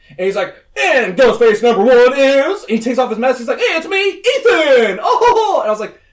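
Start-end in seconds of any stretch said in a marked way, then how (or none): 0.8-2.6 loud, high game-show style announcement; hammed-up villain unveiling, pitched high and loud; mock dramatic reveal. Killer announcing his partner, mock dramatic reveal. 3.6-5.6 loud, very high hammed-up unmasking; mock triumphant reveal. Killer pulls off his mask, mock triumphant reveal.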